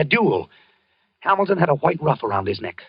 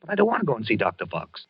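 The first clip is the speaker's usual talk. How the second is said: normal talking voice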